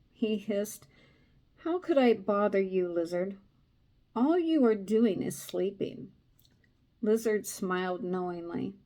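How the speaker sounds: steady storytelling read; story narration. A narrator's reading. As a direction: read the tale to a listener.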